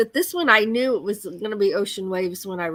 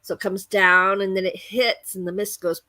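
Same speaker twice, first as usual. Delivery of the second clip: ordinary talk, nothing marked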